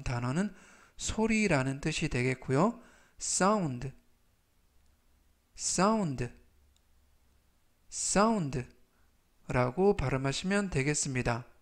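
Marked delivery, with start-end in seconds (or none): 3.2-8.6 section said slowly and clearly; slow model pronunciation. Shown as a demonstration. Teacher reading vocabulary aloud for review, slow and clear. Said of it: "제가 천천히 세 번씩 읽어드리고요".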